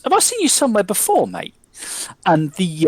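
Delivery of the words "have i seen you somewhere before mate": high, quick, squinting query; puzzled landlord. Acted. Landlord trying to place the player's face, high and quick. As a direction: ask it bright and suspicious. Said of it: "Jimmy goes".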